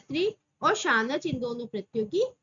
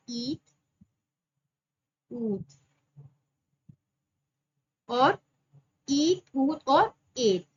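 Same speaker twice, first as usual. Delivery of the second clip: slow dictation; 3 dB louder, much slower than usual. Shown as a demonstration. Dictating the sutra terms.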